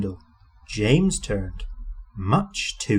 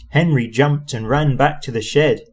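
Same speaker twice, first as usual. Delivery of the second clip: plain reading voice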